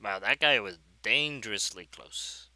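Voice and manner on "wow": ordinary talk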